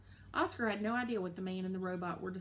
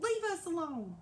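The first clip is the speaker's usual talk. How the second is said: high booming robot howl; 10 semitones higher, 3 dB louder than usual. Acted. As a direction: howl it out in pain. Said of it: "she howled".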